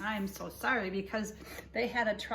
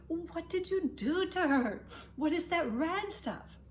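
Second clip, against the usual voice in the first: higher, slow shocked mom voice; aghast at the mess; alarmed mother; 8 semitones higher, 3 dB louder than usual. Acted.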